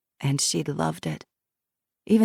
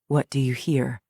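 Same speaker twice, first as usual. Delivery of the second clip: lower, steady guiding voice; calm questioner; 3 semitones lower than usual. Acted. Jackson guides Shiloh through the memory, low calm prompting. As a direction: gently prompt her to go on.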